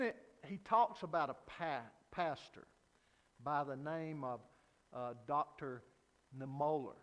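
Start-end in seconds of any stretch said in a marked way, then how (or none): none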